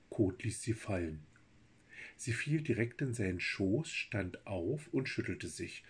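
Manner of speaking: plain reading voice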